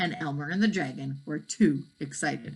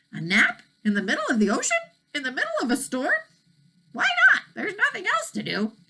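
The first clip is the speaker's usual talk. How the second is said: rising, disbelieving questions; can't believe the idea at all; incredulous boy; 12 semitones higher, 4 dB louder, faster than usual. Acted. Elmer questions a nap in the storm, incredulous.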